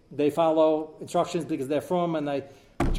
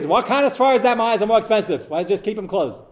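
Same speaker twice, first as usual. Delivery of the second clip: higher, louder indignant mother quote; angry mother; 5 semitones higher, 7 dB louder than usual. Retold or imitated. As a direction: fling it back as the offended mother. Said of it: "She called angry".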